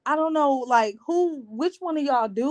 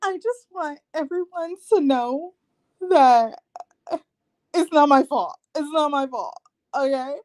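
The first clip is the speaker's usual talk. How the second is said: higher, mock-whiny self-pity; mock Alyssa; 4 semitones higher than usual. Mocking Alyssa's reunion speech in a whiny voice. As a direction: imitate her pleading innocence. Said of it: "Alyssa said".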